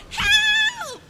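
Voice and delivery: very high squeal for help; cry for help. Acted. The other sister panicking, high squeal. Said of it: "the other one cried".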